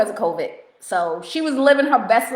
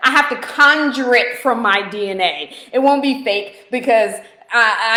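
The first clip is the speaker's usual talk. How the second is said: ordinary delivery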